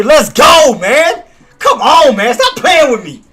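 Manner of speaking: loud, high triumphant shouting; hyped celebration. Real, not acted. Streamer hyping his chat over a winning trade, loud excited cheer. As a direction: cheer like the trade just paid.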